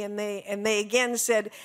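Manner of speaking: ordinary talk, nothing marked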